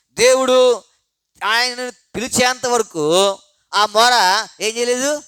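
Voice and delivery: rising preaching. Real, not acted. Preacher urging the church about the blind man's cry: loud, slow build.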